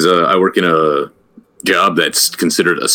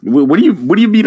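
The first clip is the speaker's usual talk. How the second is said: incredulous; 10 semitones higher, faster than usual. Real, not acted. Baffled at cohost's rant.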